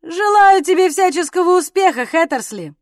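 high, loud call back; shouted reply. A narrator's reading. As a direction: shout it back across the room. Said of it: "крикнул в ответ Артур".

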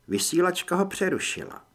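usual speaking voice

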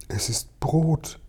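high, slow, hushed wonder; tearful whispering. A narrator's reading. Starving old man handed bread, tearfully hushed. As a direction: marvel softly, close to tears. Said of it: "dann begann er zu weinen".

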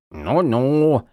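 a sprite faking a gruff growl; mock gruff growl. A narrator's reading. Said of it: "низким голосом прорычала она". (шутливое рычание)

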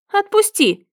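high, sharp cry; shouting. A narrator's reading. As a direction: yell to be let go. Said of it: "закричала".

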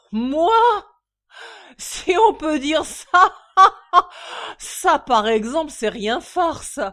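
high, loud guffawing disbelief; laughing drunk. A narrator's reading. Drunk father laughing at being called drunk, guffawing. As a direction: crack up at the idea.

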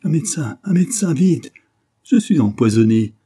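loud, high cry for help; desperate poisoned man yelling; hoarse howl. A narrator's reading. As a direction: howl it out in agony, hoarse and urgent. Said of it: "il hurla d'une voix rauque".

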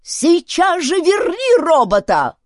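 high, loud drawn-out demand; enraged chief yelling; angry shouting. A narrator's reading. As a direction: shout an order in a squeal of rage. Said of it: "кричал он".

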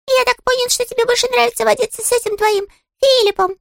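very high, tiny sulky voice; a small touchy critter, piping high; squeaky little creature. Acted. Щепкин's squeaky character voice.

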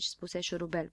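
usual speaking voice

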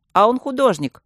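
cheeky classroom heckle; shouted out. A narrator's reading. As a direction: blurt it out across the class. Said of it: "выкрикнул Юрка Петухов".

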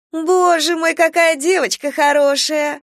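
put-on high cooing gush at a child; baby talk. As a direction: coo over the little girl. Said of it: "сюсюкает со мной незнакомка".

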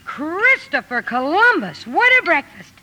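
high-pitched, gleeful wonder; thrilled exclamation, drawn out at first; amazed delight. Acted. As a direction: marvel at an unexpected feast. Amazed at lavish Christmas breakfast.

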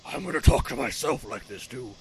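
low, whispery vigilante voice; mock hero rasp without full voice; Batman. Hero talking to himself, low hoarse whisper. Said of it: "still in the Batman voice".

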